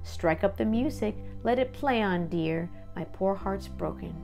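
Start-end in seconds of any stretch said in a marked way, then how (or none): none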